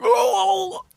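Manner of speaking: loud, high mock groan of distress; hammed-up pained wail; Obi-Wan. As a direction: cry out as if suddenly struck. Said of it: "cuts to Obi-Wan going".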